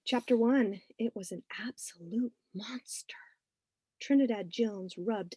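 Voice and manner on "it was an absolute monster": whisper. A narrator's reading. Eerie whispered line in reading.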